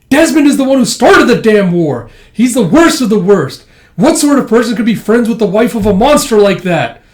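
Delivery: high, loud acted denunciation; outraged tirade. Denouncing Desmond for starting the war, outraged. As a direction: rail against a hated figure.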